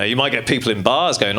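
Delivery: ordinary talk, nothing marked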